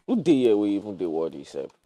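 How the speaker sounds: usual speaking voice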